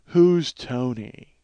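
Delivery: deadpan droll checker, drawn out. Retold or imitated. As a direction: drop it flat and slow like a bored professor. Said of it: "he looks up drolly".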